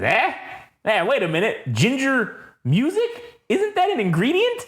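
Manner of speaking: high, rising puzzled questions; mock confused host. Reading Takeshi's line to Kaori, high puzzled disbelief. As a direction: play the baffled radio host, voice climbing.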